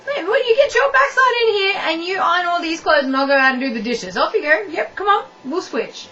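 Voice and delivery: high, loud mock comeback. Partner snapping back about ironing, high and loud. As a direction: fire back at your partner, playful.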